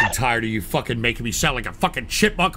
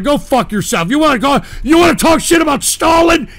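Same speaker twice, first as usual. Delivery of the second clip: higher, louder challenging yell; furious taunt; angry shout; 9 semitones higher, 6 dB louder than usual. Real, not acted. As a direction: pick a fight with the caller. Kicking caller off.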